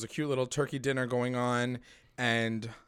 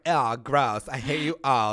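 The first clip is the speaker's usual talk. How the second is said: louder, disgusted mock; 5 dB louder than usual. Veronica sneering at the family, mock disgusted. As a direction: sneer it at the table, hammed-up.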